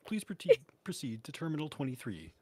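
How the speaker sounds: a hint of an airport announcer, loud; airport announcement. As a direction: page passengers like a gate announcement. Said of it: "an airport announcer voice".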